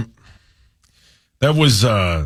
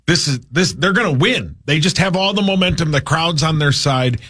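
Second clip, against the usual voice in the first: excited fan reaction; 8 semitones higher than usual. Retold or imitated. Acts out excited fans' reaction.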